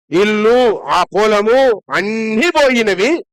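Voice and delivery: ordinary delivery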